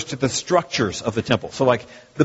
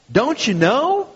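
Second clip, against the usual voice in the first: higher, rising Southern drawl; 7 semitones higher, slower than usual. Acted. Preacher voicing Paul's question to believers, Southern drawl. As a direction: ask it with a Southern lilt. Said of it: "Paul's speaking Southern".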